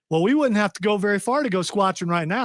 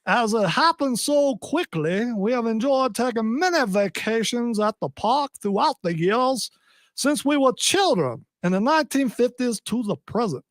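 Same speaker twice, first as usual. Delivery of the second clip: genteel Southern accent; 4 semitones higher than usual. Shown as a demonstration. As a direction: savour each word like a refined old Southerner. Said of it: "Should have read it in that accent".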